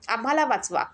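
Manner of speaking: ordinary delivery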